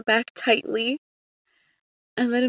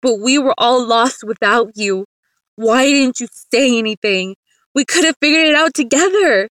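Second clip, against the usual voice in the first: loud, anguished protest; impassioned outburst, rising in pitch; crying out; 2 semitones higher, 8 dB louder than usual. A narrator's reading. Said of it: "Mister Gomez cried out".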